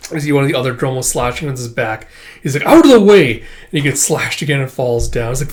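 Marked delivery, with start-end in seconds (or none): 2.7-3.4 loud, high battle cry; acted urgent command; urgent shout. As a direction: yell to clear the path.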